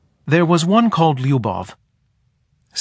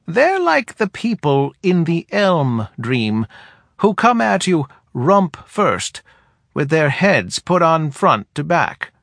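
put-on higher, dry old woman; wry, higher elder woman voice; head woman voice; 6 semitones higher than usual. A narrator's reading.

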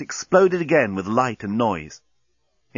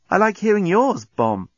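put-on higher-pitched young heroine; friendly girl; 9 semitones higher, 3 dB louder than usual. A narrator's reading. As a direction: answer the bomb in a high voice. Girl replies to the bomb.

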